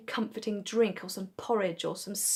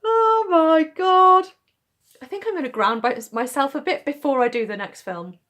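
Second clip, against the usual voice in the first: lightheaded; 8 semitones higher, 11 dB louder than usual. Real, not acted. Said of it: "I'm really lightheaded".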